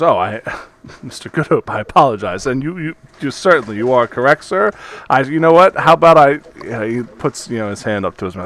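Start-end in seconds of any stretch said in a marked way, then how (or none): 0.0-6.4 nervous, fawning apology rising louder; flustered shopkeeper. Acted. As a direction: backpedal eagerly to please. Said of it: "and he goes".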